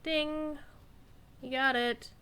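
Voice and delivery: normal talking voice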